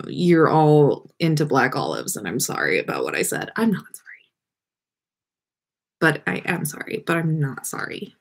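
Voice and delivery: usual speaking voice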